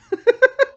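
high burst of laughter; loud laugh. Real, not acted.

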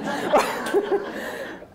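high, breathy laugh. Real, not acted. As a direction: break into a real high-pitched laugh. Laughs after cutting quote short.

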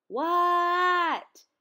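long, high, loud holler back; yelled answer. A narrator's reading. Froggy answering friend's yell, yelled answer. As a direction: holler back, stretching the word out.

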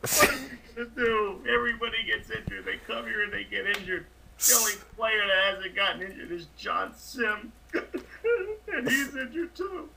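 high-pitched wail breaking into sobs; tearful despair. Acted. Coach laments every injury to his manager, tearful despair. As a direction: wail it, cracking into a tearful whine.

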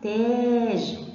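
slow, long-held vowel in تاج. Shown as a demonstration. Teacher gives an example word, long aa in تاج. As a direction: stretch the aa in تاج before the final consonant. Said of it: "طولت صوت التاء".

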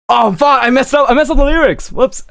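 flustered. Real, not acted. Flustered after mistake.

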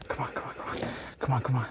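whispered impatient come on; hushed impatient pleading; hushed urging. Real, not acted. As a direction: urge the device on in a whisper. Urging something to work, hushed.

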